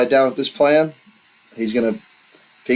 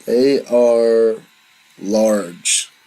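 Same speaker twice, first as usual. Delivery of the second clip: slow, deliberate; 2 semitones lower, much slower than usual. Real, not acted. Game master warning player about demons' size, slow and deliberate. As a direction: pause before "large" and let it sink.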